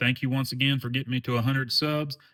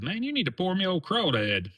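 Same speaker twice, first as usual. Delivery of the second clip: much higher, eager pitch, mock pleading; excited retold request; 9 semitones higher than usual. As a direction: replay your excited ask to a friend. Retells his excited request.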